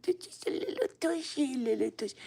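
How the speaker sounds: high cooing voice, easing down; baby talk. Retold or imitated. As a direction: imitate yourself cooing at something cute. Quotes own baby talk.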